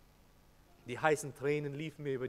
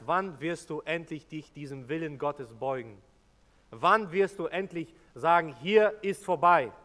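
rising sermon plea; urgent preaching appeal; 2 semitones higher, 8 dB louder than usual. Real, not acted. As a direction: press the listeners to decide.

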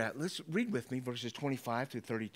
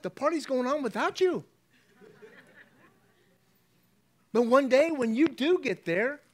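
louder and higher, building joy; rising excitement; 8 semitones higher, 5 dB louder than usual. Real, not acted. Pastor building to joy over heaven's party, louder. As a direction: preach it up with growing excitement.

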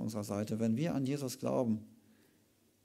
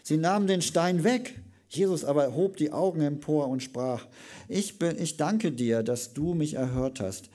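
higher, louder reading building to the call; loud, higher ringing Bible reading; dramatic scripture reading; 4 semitones higher, 6 dB louder than usual. Acted. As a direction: proclaim Jesus' prayer and the call to Lazarus. Preacher reads scripture aloud.

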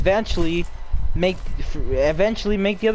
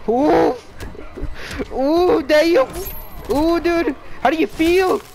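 hyped taunting; 8 semitones higher, slower than usual. Real, not acted. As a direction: taunt him, all hyped up. Hyped taunting during fight.

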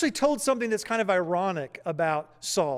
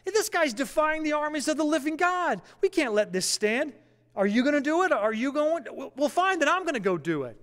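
put-on high, incredulous, fired-up young challenger; rally the cowering soldiers, pitched up high; indignant disbelief; 7 semitones higher than usual. David scolds the frightened army, pitched up with disbelief. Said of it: "he's like".

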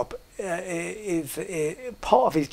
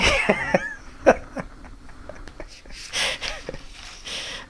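high breathless laugh. Real, not acted. Laughing at own joke.

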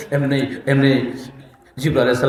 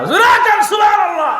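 rally the audience to answer back; call to the crowd. Real, not acted. Preacher calls crowd to respond.

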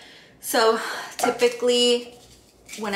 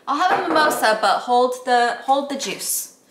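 playful ordering voice; 5 semitones higher, 8 dB louder than usual. Retold or imitated. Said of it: "It's like".

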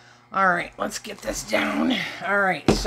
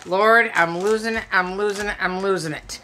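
usual speaking voice

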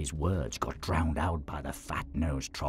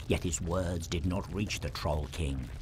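ordinary talk

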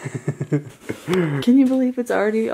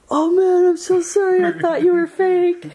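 high, loud panicked apology; mock scared guy, slowing down; imitating a startled man; 10 semitones higher, 6 dB louder than usual. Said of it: "He's like".

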